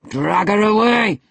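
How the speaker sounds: loud, high, drawn shout; furious bellow; savage cry. A narrator's reading. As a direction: roar the order savagely. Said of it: "he cried savagely".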